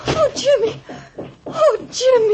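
high, sobbing call; tearful cry. Acted. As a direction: cry out his name in desperate relief. Sobbing for Jimmy as he arrives.

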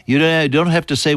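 usual speaking voice

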